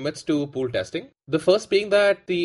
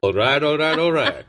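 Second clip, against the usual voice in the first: Matthew McConaughey; 4 dB louder than usual. Retold or imitated. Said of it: "Matthew McConaughey impersonators".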